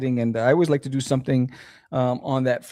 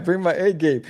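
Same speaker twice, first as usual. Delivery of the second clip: ordinary talk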